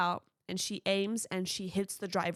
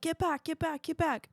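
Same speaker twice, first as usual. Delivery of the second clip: high, pushed repeated warning; urgent warning; 8 semitones higher than usual. Retold or imitated. Soldier warns everybody away from the grenade, high urgent call. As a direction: shove everyone back with the voice. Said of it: "and he's like".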